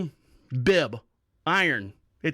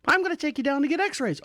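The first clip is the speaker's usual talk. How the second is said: voicing the nurse; 9 semitones higher than usual. Nurse Rachel voiced in story.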